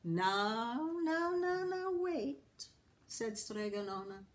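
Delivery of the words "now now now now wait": put-on old woman calming. Strega Nona calming crowd.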